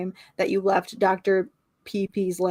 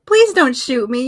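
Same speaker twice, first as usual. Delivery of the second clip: pleading cry; 4 semitones higher, 8 dB louder than usual. A narrator's reading. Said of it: "you cry".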